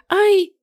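high startled yelp; sudden yelp of fright; startled cry. Acted. Toad jumps on her.

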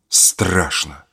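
quiet low fear; fearful. Acted. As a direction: breathe it out softly, scared. Adelka is afraid.

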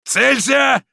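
very high, loud firing orders; full-lung bellow. A narrator's reading. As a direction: roar the command to fire. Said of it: "оравшего во всю силу".

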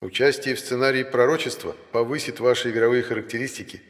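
system message read. A narrator's reading. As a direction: read it like a game notification. Reads the game system message.

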